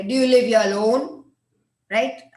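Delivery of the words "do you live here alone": insistent question, louder on "live"; pressing louder. Retold or imitated. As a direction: push him for an answer. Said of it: "intruder अब थोड़ा pressurise करके".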